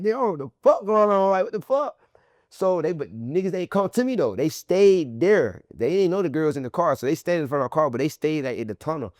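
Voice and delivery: usual speaking voice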